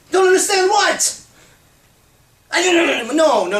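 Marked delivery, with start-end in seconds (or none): 0.1-1.2 much higher, louder outraged question; incredulous demand. Acted. Man demanding what he supposedly doesn't understand, outraged. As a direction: demand it, baffled and worked up.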